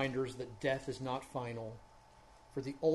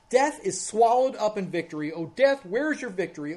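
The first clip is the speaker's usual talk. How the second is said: building louder and higher through the passage; fervent scripture reading; 4 semitones higher, 8 dB louder than usual. Acted. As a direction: proclaim it like a preacher at full force. Reading scripture with rising fervor.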